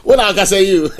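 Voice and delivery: talking through real laughter; amused, words breaking into chuckles; laughing. Real, not acted. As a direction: laugh while getting the words out. Both men burst out laughing.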